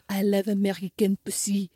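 hammed-up slow, low come-on; imitated creepy suitor. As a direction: drag it out like a sleazy lover. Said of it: "the man's going like".

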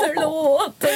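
high, wailed plea; hammed-up, heartbroken sorry; mock tearful apology. Retold tearful apology to Josef.

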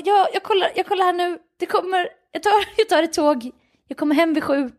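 high, flustered retold stammer; hastily placate, lightly imitating panic; mock-frantic partner searching for a fix; panicked scrambling. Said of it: "han får panik".

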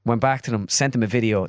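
ordinary talk

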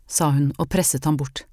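ordinary delivery, nothing marked

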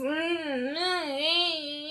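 soft high whingy hum through closed lips; whiny whinge noise. Retold or imitated. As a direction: whine like a sulking toddler. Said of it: "she goes".

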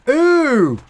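long, high, loud squeal of disgust; disgusted "eww". Real, not acted. Host hearing of lice all over the body, disgusted high groan. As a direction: recoil with a drawn-out high "eww".

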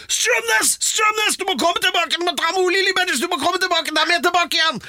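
frantic north Norwegian shouting, very high. Retold or imitated. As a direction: yell in a panicked north Norwegian accent. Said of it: "en fyr med nordnorsk dialekt da".

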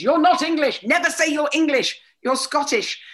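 a loud, high retelling of dad scolding; dad's rebuke. Retold or imitated. Father scolds his daughter for calling herself English, loud and high. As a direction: snap back as the offended patriotic father. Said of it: "my dad flew and he said".

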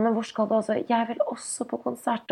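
lightly voiced whining toddler; upset child. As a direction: mimic a little boy begging to come along. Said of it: "Og da er han sånn".

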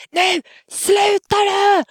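high, drawn-out imitated yell; retold scream. Parent holding the struggling child gets screamed at, imitated high scream. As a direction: re-enact a piercing, stretched-out scream. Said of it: "skriker hon och bara".

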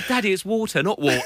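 put-on child correcting "water" to posh "water"; correcting pronunciation. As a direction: say "water" posh, then "water" common. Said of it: "I'm going".

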